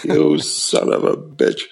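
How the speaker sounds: high, laughing mock insult; fond laughing curse at the sponsor. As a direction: curse him through a laugh.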